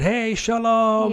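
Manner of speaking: high, drawn-out excited call; excited greeting. Retold or imitated. Tour guide greeting his group at pickup, excited. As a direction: imitate an exuberant tour guide, stretching the words. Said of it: "he was all excited".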